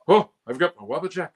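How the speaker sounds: light jokey boast; stand-up comic Sheogorath. Shown as a demonstration. Actor trying a comedian take on Sheogorath. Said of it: "have him out there talking about this".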